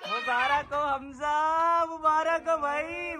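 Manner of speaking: high, slow, stretched cheer; drawn-out congratulations. Real, not acted. Friends congratulating the shy groom-to-be, drawn-out high cheer. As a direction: stretch each phrase out long and high.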